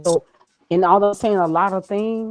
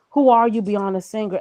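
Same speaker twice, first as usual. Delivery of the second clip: ordinary talk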